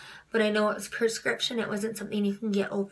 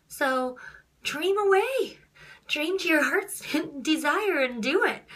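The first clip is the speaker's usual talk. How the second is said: excited encouragement; 7 semitones higher, slower than usual. Retold or imitated. Mother's rousing advice retold.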